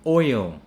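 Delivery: oil as 'oy-yol', final L sounded; punched oil, clear ending; full ending. Shown as a demonstration. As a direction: give oil two beats with a dark L. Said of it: "but it's actually oil".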